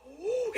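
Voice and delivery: high, sharp gasp of amazement; excited gasp. Shown as a demonstration. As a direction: gasp high at a surprise reveal. Surprise reveal for the dog.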